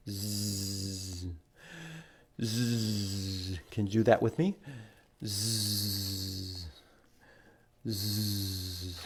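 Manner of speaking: soft, low drawn-out snore; snoring sound. Shown as a demonstration. Reader showing children how the napping goslings snore. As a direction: breathe out a sleepy snore. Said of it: "like they're snoring".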